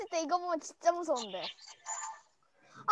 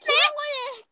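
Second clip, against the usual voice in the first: surprised call; 9 semitones higher, 11 dB louder than usual. Real, not acted. Spotting two same characters.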